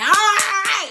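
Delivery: loud, high mock yell; exasperated, high-pitched courtroom shout; imitated judge's shout. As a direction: bellow over the other person. Judge yelling over her, retold.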